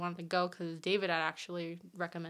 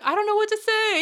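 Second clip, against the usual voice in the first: mock stranger; 13 semitones higher, 11 dB louder than usual. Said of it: "he was like".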